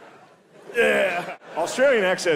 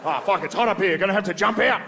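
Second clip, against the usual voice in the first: mock Australian alarm; 6 semitones higher than usual. Voicing an Australian in office.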